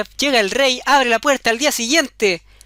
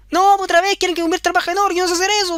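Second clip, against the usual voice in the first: put-on high, fast complaining girl; the girl complaining to the goblin again; whiny girl; 7 semitones higher, faster than usual. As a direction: rush it out high and helpless. Girl tells goblin her trouble.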